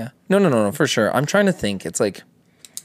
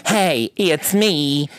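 higher, shaky scolding voice; Cat Williams impression; 7 semitones higher, much slower than usual. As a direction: tell off the fairy, shaky and high. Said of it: "How does Cat Williams talk?".

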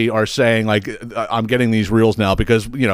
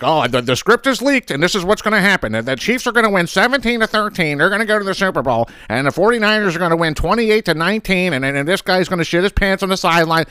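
higher, fast, whiny rising voice rattling off predictions; mocking uptalk voice; 8 semitones higher than usual. Mocking reel guys reading the leaked script in a high whine. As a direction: ham up a smug know-it-all. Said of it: "putting out reels going like".

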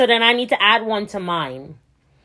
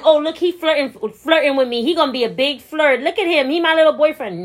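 higher-pitched imitation of flirty homegirls; mock cooing; 6 semitones higher, 3 dB louder than usual.

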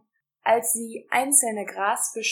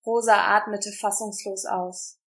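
plain reading voice

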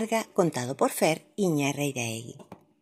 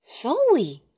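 higher, surprised at own voice; first word, wondering; 8 semitones higher than usual. A narrator's reading. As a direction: say it like a first discovery. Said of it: "repitió la princesa".

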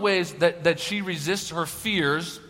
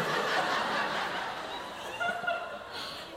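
high unvoiced laugh; breathy laugh; 11 dB quieter than usual. Real, not acted. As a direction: burst of silent laughter. Laughs at story.

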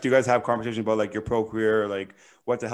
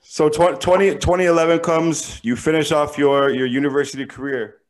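ordinary talk

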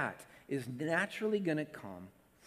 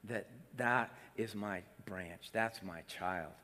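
softer, lower tender voice of Jesus; 8 semitones lower, 6 dB quieter than usual. Pretend. Preacher voicing Jesus's tender love for his child. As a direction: murmur with fond love. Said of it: "he smiles from ear to ear, just".

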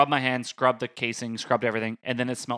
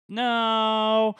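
higher, drawn-out nervous no; panicky no; 8 semitones higher than usual. Retold or imitated. Stopping girlfriend from entering, high drawn-out no. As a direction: blurt a long high no. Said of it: "I'm like".

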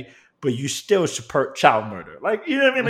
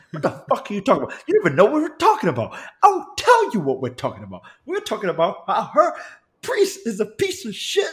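fast, high argumentative tirade slowing at the end; mock-outraged rant, pitch jumping up; retold angry rant; 8 semitones higher, faster than usual. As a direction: act out telling off a stranger, amused underneath. Acted quarrel with meddling stranger.

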